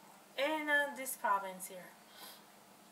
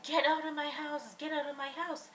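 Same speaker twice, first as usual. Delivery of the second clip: higher-pitched, louder mock yell of the roommate; imitated eviction yell; 6 semitones higher, 4 dB louder than usual. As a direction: throw her out in the roommate's voice. Roommate throwing her out.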